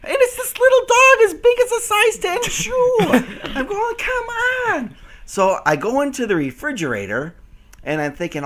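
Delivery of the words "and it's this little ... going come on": laughing disbelief. Real, not acted. Laughing, still coaxing.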